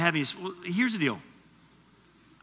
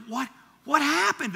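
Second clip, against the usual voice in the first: bewildered parent; 9 semitones higher than usual. Retold or imitated. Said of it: "I'm just like".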